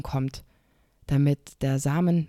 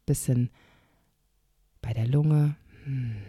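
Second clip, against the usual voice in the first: guided meditation. Shown as a demonstration. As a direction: lead a gentle inner journey. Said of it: "eine kleine Meditationsübung mit dir machen".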